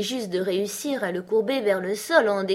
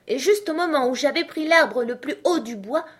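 higher, louder pigeon complaint; rising voice; 7 semitones higher, 5 dB louder than usual. A narrator's reading. Pigeon complaining about its chosen tree, voice rising. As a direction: let the grievance climb in pitch. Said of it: "dont la voix montait jusqu'à".

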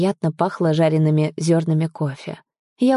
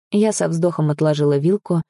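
usual speaking voice

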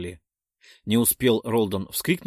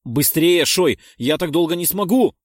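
loud, higher strained shout; 6 semitones higher, 8 dB louder than usual. A narrator's reading. Willis shouts to Shoy that he can't hold on. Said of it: "прокричал Виллис".

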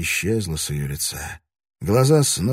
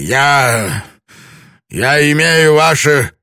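loud, much higher strained demand; desperate insisting; 10 semitones higher, 10 dB louder than usual. A narrator's reading. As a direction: force out each word in fury. Lord insisting, barely controlled.

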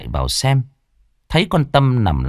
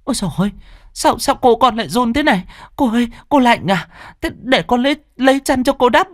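panicked maid; faster than usual. Acted. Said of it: "hốt hoảng".

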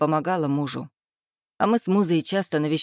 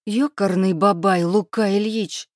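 irate, higher and a bit louder; furious hiss; 4 semitones higher, 4 dB louder than usual. A narrator's reading. Furious muse scolds her man after his restaurant fight, angrily. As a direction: spit out the curse at him. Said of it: "яростно шипела моя муза".

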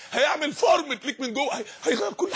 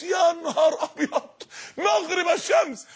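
stretched out in slow wonder, pitched up; amazed exclamation; 6 semitones higher, slower than usual. Acted. Preacher marvelling at Dhul-Qarnayn's journey, slow and awed. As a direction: gasp at the distance he travelled.

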